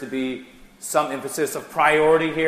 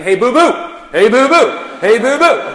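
much higher and louder, cartoonish singsong "Hey, boo-boo"; silly Yogi-style call; 9 semitones higher, 10 dB louder than usual. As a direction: ham it up like a cartoon bear chum. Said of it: "I started saying".

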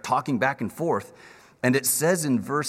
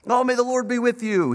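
cheerful high greeting as Boaz; 8 semitones higher, 5 dB louder than usual. Retold or imitated. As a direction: call out a blessing to the workers. Boaz greeting his servants.

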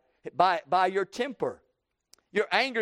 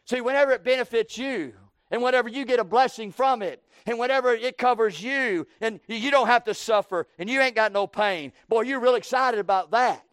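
building, louder preaching climb; rising preaching; 5 semitones higher, 3 dB louder than usual. Real, not acted. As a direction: build the fervor line by line.

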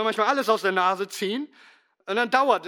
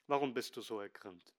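sanft, leise gefragt; 7 semitones lower, 12 dB quieter than usual. Acted. Zärtliche Wiederholung der Gottesfrage.

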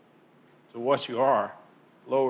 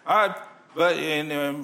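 normal talking voice

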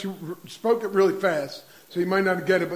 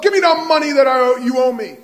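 higher, louder, fast furious shakedown; angry demand; 6 semitones higher, 10 dB louder, faster than usual. Retold or imitated. The pardoned servant choking his fellow servant, fast and furious. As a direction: bark the demand like you've got him by the throat.

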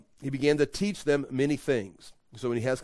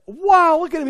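loud, high wow of a wowed crowd; big hammed-up marvelling; amazed onlooker; 12 dB louder than usual. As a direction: burst out in acted amazement. Voicing amazed crowd watching miracle.

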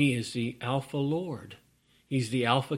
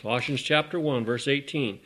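ordinary talk

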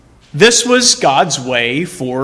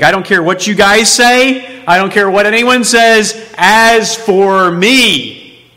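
raised, insistent declaration, last words drawn out; defiant affirmation; 5 semitones higher, 3 dB louder than usual. Acted. Preacher as Job answering his friends, defiantly affirming. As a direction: declare it firmly over all objections. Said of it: "he gives this affirmation".

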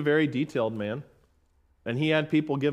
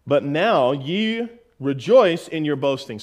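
slow, louder scripture reading; 6 dB louder, slower than usual. Shown as a demonstration. Preacher reading James on boasting, slow and grave. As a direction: read it slowly and gravely.

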